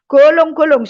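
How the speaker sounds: retold wailing, hammed up; imitated loud wailing. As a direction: bawl it out like the sobbing man. Man wailing loudly on the bus.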